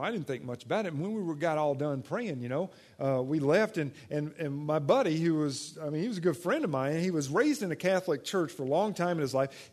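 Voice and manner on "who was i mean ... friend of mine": usual speaking voice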